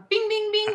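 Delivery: high sung bing bing like a quiz show bell; correct answer chime. Pretend. Cheering her cohost's Bond pick with a high chime. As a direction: ring the winner bell with your voice.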